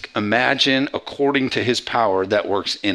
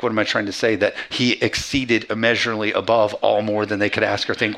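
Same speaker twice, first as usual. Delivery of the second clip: ordinary talk, nothing marked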